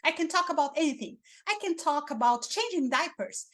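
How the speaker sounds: excited upbeat voice. Shown as a demonstration. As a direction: sell it like the most thrilling topic ever. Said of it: "If I speak in this tone of voice".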